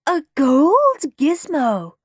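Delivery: high, drawn-out amazement; gasping wonder. A narrator's reading. Peter unwrapping the rare gold toy, amazed. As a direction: marvel at it slowly. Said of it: "gasped Peter".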